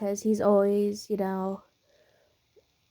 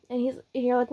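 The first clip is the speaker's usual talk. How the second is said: plain reading voice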